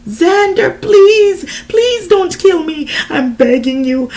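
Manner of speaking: high, loud, frantic begging; desperate pleading. A narrator's reading. Desiree pleading with her attacker Xander, frantic begging. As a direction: beg for your life. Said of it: "I pleaded with him".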